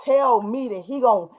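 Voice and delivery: ordinary talk, nothing marked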